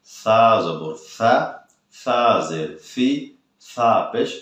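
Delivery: slow, drawn-out সা জবর সা; unhurried model reading. Shown as a demonstration. Teacher showing students how to read, slow drawn-out drill. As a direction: stretch each letter name out slowly, syllable by syllable. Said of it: "এভাবে আপনারা পড়বেন".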